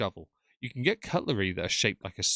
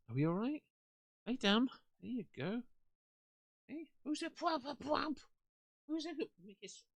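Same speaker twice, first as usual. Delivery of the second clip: talking to a dog; 11 semitones higher, 8 dB quieter than usual. Real, not acted. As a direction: coo at the dog like a puppy. Settling the dog beside her.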